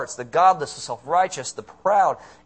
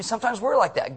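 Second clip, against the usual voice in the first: ordinary delivery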